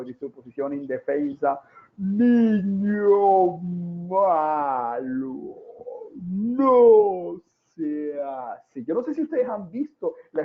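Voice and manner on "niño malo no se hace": drawn-out booming scold, much slower; slow-motion scolding. Retold or imitated. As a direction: stretch every word like a slowed-down film. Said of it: "el niño lo ve como en cámara lenta".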